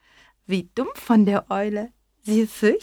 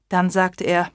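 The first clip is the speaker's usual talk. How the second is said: usual speaking voice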